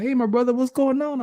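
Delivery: high-pitched, singing hello; prophet's hearty greeting. Retold or imitated. Prophet answering the late phone call, high friendly greeting. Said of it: "He's like".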